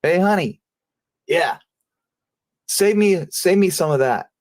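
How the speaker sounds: wife on the phone calls to her husband on the couch, high sing-song; wife calling sweetly. Retold or imitated. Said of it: "So I hear her say".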